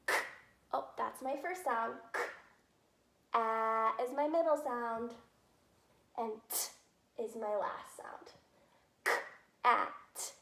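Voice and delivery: "cat" split into k, a, t sounds, then blended "K, at"; sounding out words. Shown as a demonstration. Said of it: "show you how to do that".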